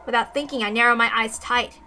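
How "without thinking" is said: plain reading voice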